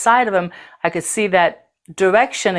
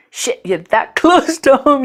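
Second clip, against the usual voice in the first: a laugh breaking in as the feeling rises; emotional laugh; 6 semitones higher than usual. Real, not acted. Recalling being close to home, with an emotional laugh. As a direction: laugh through the words, higher and moved.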